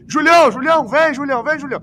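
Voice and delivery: high, urgent repeated calling; imitated coach's call. Host mimics coach summoning the substitute, high calling out. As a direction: summon a substitute from across the pitch. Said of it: "ele grita bem assim".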